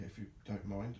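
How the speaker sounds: normal talking voice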